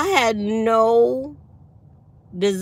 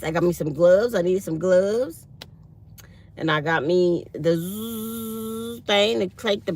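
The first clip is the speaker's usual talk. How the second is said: ordinary talk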